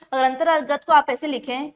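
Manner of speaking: ordinary talk, nothing marked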